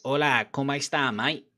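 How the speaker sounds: Spanish greeting in broad Cockney; Cockney-Spanish mash-up, played for laughs; Cockney Spanish accent. Mimicking Bellerin, the Cockney Spaniard, jokey Cockney-Spanish accent. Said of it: "bro, the Cockney Spaniard".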